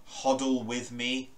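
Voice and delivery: ordinary delivery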